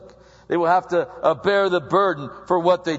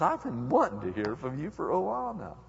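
1 God answering gently; 6 dB quieter than usual. Acted. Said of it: "He goes".

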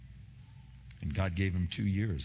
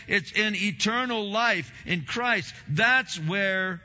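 high, loud fervent preaching; preaching at full force; 11 semitones higher, 6 dB louder than usual. Acted. Pastor preaching hope to saints, fervent loud sermon. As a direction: lift the congregation with a ringing sermon cry.

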